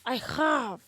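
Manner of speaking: host copies "I have" in a mock Russian accent; imitating Russian accent. Shown as a demonstration. Host copying her Russian "I have". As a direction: repeat it like a learner trying a Russian h. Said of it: "in Russian you say".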